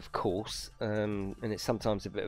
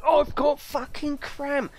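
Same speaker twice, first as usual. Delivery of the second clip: high, slow and dramatic moan; milk the cramp for all it's worth; exaggerated complaint; 14 semitones higher, 4 dB louder, slower than usual. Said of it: "I'm a bit exaggerated with everything I do".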